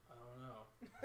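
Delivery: imitating a drunk friend, very soft and low; drunk mumble. Drunk friend dodging where the girls went, feeble mumble. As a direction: drop to a low, hushed non-answer.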